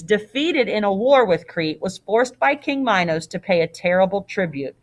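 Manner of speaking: ordinary delivery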